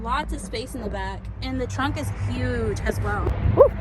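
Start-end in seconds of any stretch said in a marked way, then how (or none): 3.5-3.7 happy whoop of delight; a real excited woo. Real, not acted. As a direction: cheer out loud about the car. Excited about the new car.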